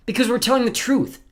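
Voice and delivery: put-on high, loud insistent boy; crying out. A narrator's reading. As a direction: cry out in protest, pitched high. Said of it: "Klaus cried".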